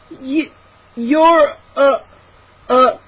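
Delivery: high, broken struggling words; weak, halting. A narrator's reading. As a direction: stammer as if barely able to speak. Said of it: "coughed weakly".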